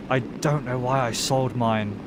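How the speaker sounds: normal talking voice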